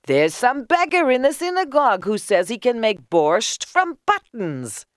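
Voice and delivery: high, excited report; high-pitched crowd voice; calling back. A narrator's reading. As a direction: shout the news up to neighbours. Crowd calls back to onlookers.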